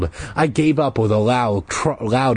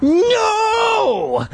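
howl. Acted. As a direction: scream it at the locked door. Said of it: "I let out another howl".

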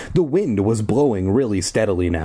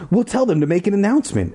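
fast, high and eager; eager cry; 10 semitones higher, faster than usual. Acted. Ashley hurrying off with the plan, fast and eager. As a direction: rush the plan out. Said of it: "Ashley cried".

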